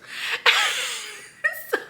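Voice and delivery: a burst of high laughter; high squealing laugh. Real, not acted. Laughing at the plot.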